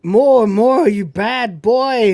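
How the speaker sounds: hammed-up seductress; seductive Nadia. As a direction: egg him on slowly and loudly. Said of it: "Nadia's like".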